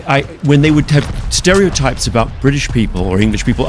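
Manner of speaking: normal talking voice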